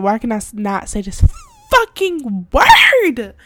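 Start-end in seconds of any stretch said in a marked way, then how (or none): 1.7-3.3 higher, louder, slow exasperation; exasperated. Real, not acted. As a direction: drag out the frustration. Frustrated at mispronouncing.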